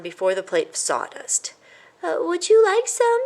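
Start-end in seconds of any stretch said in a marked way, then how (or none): 2.0-3.2 weak. A narrator's reading. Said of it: "he asked weakly".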